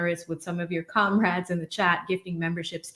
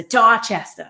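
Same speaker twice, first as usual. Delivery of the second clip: Boston accent; 3 semitones higher, 9 dB louder, slower than usual. Shown as a demonstration. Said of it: "that's how people pronounce it".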